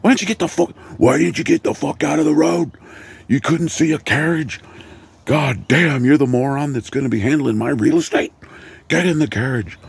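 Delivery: high-pitched mock-angry rant; cussing out. Count Orlok to Holt blocking the road, high mock-angry cussing. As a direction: berate him in a high, exasperated voice. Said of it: "I would cuss Holt out".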